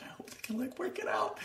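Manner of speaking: breathy unvoiced whisper; whispered hope. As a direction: whisper it as if hoping silently. Inner hope, quoted thought.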